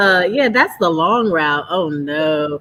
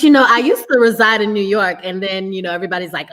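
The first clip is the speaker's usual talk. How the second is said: ordinary talk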